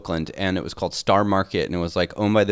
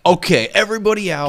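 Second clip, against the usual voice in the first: high, loud mock announcement; mock wife shout; 8 semitones higher, 4 dB louder than usual. Wife kicking everyone out of the party, mock shout. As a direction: shoo the guests out.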